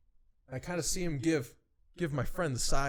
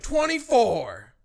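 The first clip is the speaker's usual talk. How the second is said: drawn-out loud echo, higher then falling; loud incredulous repeat; 7 semitones higher, 10 dB louder, much slower than usual. Retold or imitated. Correcting what the boss really said, loud and drawn-out. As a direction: stretch it out in mock disbelief, short of roaring. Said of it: "No, he just like".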